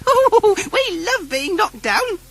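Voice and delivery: very high, loud hooting giggle; giggling. Acted. The Skittle children giggle as fruit knocks them down, very high. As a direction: squeal with delight. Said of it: "they giggled".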